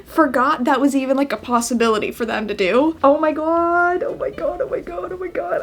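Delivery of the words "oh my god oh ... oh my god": high, fading to a hushed gush; gushing oh my god. Real, not acted. Fan gushing to viewers about her favourite song, high and fading.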